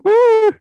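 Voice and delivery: high, loud "woo"; mock crowd woo. Imitating everybody hearing the viral song, high whoop. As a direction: whoop it like an excited listener. Imitating excited TikTok listeners.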